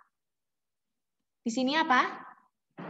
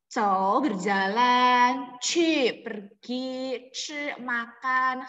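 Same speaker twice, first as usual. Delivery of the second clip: slow listing; much slower than usual. Shown as a demonstration. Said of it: "teacher listing verbs slowly".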